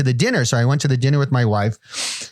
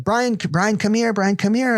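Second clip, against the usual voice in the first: calling out; 8 semitones higher than usual. Retold or imitated. Aunt calling him over.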